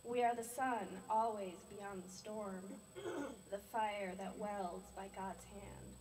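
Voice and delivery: slow, measured poetry recital; deliberate verse with drawn-out line endings; love poem reading. Acted. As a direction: recite a love poem, unhurried, pausing between phrases. Reads love poem for husband.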